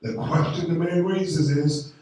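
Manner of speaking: preaching at full force. Acted. Pastor pressing the congregation about faith, preaching at full force. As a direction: drive it home to the congregation.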